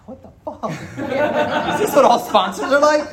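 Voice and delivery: incredulous, cracking up; half-laughed what the fuck; laughing disbelief. Retold or imitated. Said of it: "I'm like".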